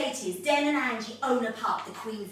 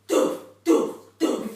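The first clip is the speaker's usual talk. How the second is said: EastEnders drum hits; 8 dB louder, slower than usual. Shown as a demonstration. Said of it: "I'm just going to say".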